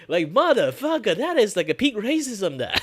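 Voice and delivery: high, loud indignant outburst; loud exasperated cursing; outraged rant. Real, not acted. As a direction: blow up at the absurdity. Outraged rant at racist casting.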